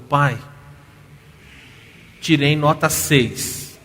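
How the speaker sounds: loud, slow, tearful retold plea; crying plea. Daughter confessing a bad grade to her father, loud tearful plea. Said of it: "A filha tá chorando".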